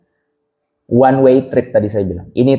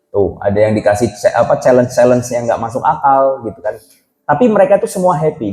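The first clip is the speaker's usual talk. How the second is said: ordinary delivery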